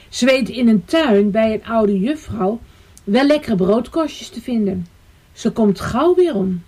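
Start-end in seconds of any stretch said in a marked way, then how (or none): none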